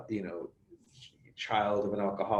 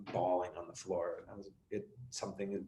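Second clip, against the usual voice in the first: ordinary talk, nothing marked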